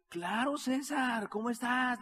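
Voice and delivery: high, drawn-out hello; hearty phone greeting. Retold or imitated. Genaro greets César on the phone, hearty.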